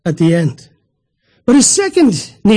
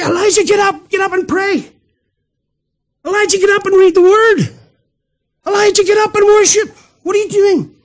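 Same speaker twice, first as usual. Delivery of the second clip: the angel calling out, pitched well higher; urgent angel; 10 semitones higher than usual. Acted. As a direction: rouse the sleeping prophet with rising urgency. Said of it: "What does the angel say to him?".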